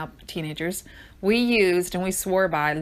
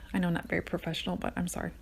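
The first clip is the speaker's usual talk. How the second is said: usual speaking voice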